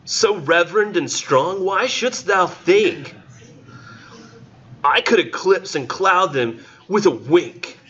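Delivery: high, slow, theatrical poetry recital; lofty slow recital, pitched high; weird dramatic reading voice. Acted. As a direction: declaim it like an old stage actor. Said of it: "read this with this weird voice".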